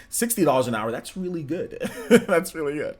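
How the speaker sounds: chuckling through the words; laughing. Real, not acted. Laughing at chat misreading sixteen as sixty. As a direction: laugh it off at the mix-up. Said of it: "I didn't say sixty dollars an hour".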